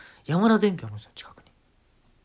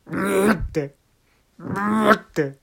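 hammed-up retching groan, higher; mock retching; 5 semitones higher, 6 dB louder, much slower than usual. Comedian shows the band his fake vomiting, mock retching. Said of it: "嘔吐するんです".

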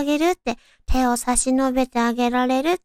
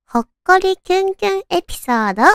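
higher, louder, fast and bubbly; excited; 6 semitones higher, 5 dB louder, faster than usual. Real, not acted. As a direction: sell the theme with excitement. Announcing the theme excitedly.

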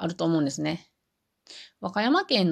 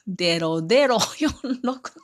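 lightly imitated Wakayama auntie reading out digits, デロ with d; a hint of a local auntie saying デロ五三; 4 semitones higher than usual. Speaker retelling an auntie reading out a phone number, light Wakayama accent. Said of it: "おばさんが言うてくれる時に".

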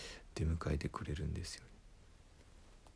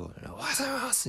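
much higher, shouted army greeting; military-style greeting. Retold or imitated. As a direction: bark it like a drill recruit. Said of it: "軍隊っぽく".

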